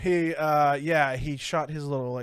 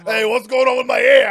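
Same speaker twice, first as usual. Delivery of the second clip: loud, high joking exclamation; hammed-up loud complaint; mock outcry; 7 semitones higher, 12 dB louder than usual. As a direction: shout it in pretend alarm. Joking outburst about hair.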